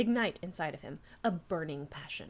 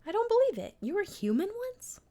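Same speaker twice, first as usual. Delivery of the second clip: astonished; 13 semitones higher than usual. Acted. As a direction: gasp it out in disbelief. Astonished at the monster's past.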